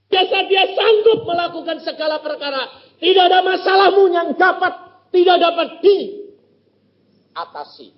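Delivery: full-force preaching. Acted. Preacher exhorting the congregation, loud and high. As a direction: proclaim it to the whole hall.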